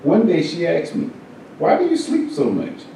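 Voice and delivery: usual speaking voice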